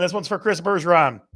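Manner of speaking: normal talking voice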